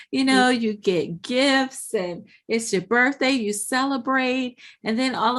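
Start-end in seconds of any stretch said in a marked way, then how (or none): none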